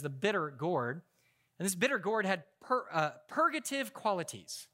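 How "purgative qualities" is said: ordinary talk, nothing marked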